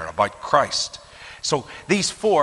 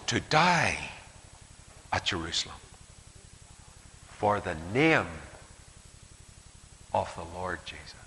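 solemn reverent reading; 3 semitones lower, 3 dB quieter than usual. Acted. Preacher reading Paul's brave answer to the church, hushed. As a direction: let each phrase land with awe.

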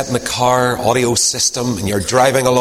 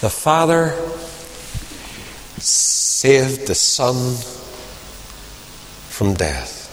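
slow emphasis; 4 dB quieter, much slower than usual. Acted. Preacher's key line, slowed.

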